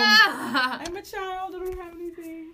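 high, slow fake whimper; innocent child voice. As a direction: play the crying kid caught. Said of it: "and I was like".

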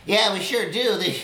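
high, loud scared villager; a high pitched frightened local; worried townsfolk. Acted. Townsfolk role.

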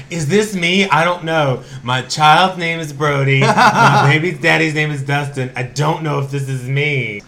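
high, loud, laughing imitation of the coworker; laughing mock Valley girl. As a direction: giggle through a ditzy impression of the coworker. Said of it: "She'll be like".

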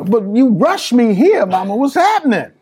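high-pitched retold bewilderment; baffled protest. Son rushed home by Mama, baffled protest. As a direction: question her, voice pushed well up.

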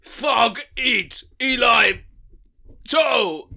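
loud, halting caveman grunts; caveman speak. Acted. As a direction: bark it out like a dim caveman, word by word. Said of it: "is it caveman speak now?".

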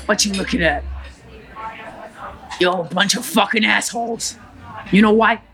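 put-on thickly accented gangster monologue; defiant, punchy Cuban tough guy; Tony Montana. Gangster addresses a crowd of onlookers, defiant character voice. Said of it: "to Tony Montana".

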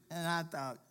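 ordinary talk, nothing marked

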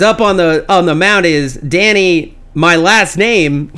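slow, drawn-out ballpark announcer; imitated stadium introduction, stretching each name. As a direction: announce the player coming to the mound. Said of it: "for the announcer voice".

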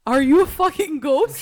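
incredulous demand. Retold or imitated. Incredulous friend retelling her line to clerk.

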